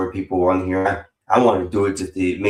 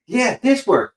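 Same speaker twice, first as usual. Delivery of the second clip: pitched way up, a teasing Vanta impression; mock Vanta insisting; 13 semitones higher, slower than usual. Retelling the slang argument, mocks Vanta insisting, high-pitched. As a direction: parrot Vanta's insistence, much higher. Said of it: "He goes".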